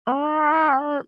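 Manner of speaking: long high dog whine; imitated drawn-out puppy yowl; dog trying to talk. Puppy behind his gate waiting for food, imitated whine. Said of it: "trying to talk".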